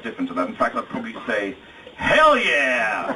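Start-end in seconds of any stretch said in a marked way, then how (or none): 2.0-3.1 loud, higher gung-ho cheer; exuberant whoop. Acted. Groom giving his new native answer, exuberant whoop. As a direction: holler it like a convert gone native.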